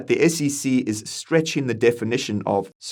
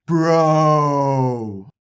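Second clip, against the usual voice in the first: loud cocky finance bro; 4 semitones higher, 4 dB louder than usual. Acted. Bros yelling bro.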